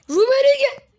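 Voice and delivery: high, loud cry of the name; excited shout. Real, not acted. Gamer shrieking a player's name at the shot, high and loud. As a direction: yell the player's name as he strikes.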